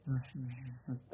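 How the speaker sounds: short hissed zzz noises; imitated slithering buzz. Telling of a snake nearby at night, imitated buzzing. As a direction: imitate something sliding along the ground. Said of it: "had a sense that there'd been this".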